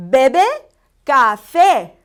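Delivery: Bebe loud and rising, café falling; deliberate teacher model; slow Spanish drill. Shown as a demonstration. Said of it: "Try two more times".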